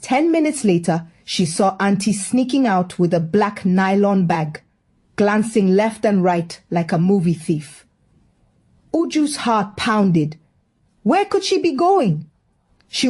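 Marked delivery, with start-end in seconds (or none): none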